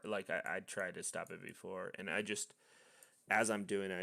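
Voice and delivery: normal talking voice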